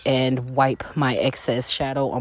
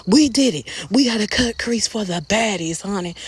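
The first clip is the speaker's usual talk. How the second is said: diva triumph; 7 semitones higher than usual. Pretend.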